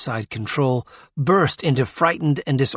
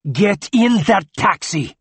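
slow, loud, high command; exasperated shout, drawn out; angry order; 8 semitones higher, 6 dB louder than usual. A narrator's reading. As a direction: order an insolent child, each word forced out. Angry grandfather orders insolent boy.

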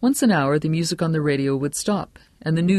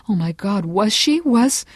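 higher, louder, dawning horror; rising panic; 7 semitones higher, 4 dB louder than usual. Acted. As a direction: let the dread climb to a peak. Murmuring crowd's dread builds.

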